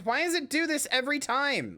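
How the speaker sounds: high-pitched exasperated complaint. Real, not acted. Streamer fed up with sound failing, exasperated. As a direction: complain in rising frustration. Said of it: "I'm so mad".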